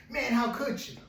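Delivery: high-pitched, exasperated acted scolding; upset. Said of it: "the guy is so upset".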